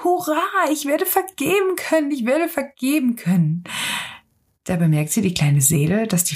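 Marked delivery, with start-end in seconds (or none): 0.0-3.6 very high, gleeful cheering; elated, high voice at moderate volume; jubilant sing-song cry. A narrator's reading. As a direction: cheer it like a child skipping about. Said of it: "sprang umher und sang".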